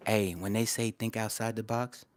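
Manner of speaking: ordinary delivery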